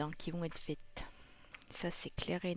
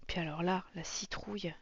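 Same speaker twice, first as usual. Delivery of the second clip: ordinary talk, nothing marked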